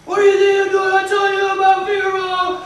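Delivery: high, loud mock yelling in someone's face; imitating an enraged coach's shouted tirade; coach ripping in. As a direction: chew out a player at full shout. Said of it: "ripping it into me".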